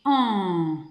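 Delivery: "en" held long as one nasal vowel, falling; isolated vowel sound. Shown as a demonstration. Teacher giving the answer, "en" held long. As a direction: sustain "en" on its own. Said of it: "C'est le son".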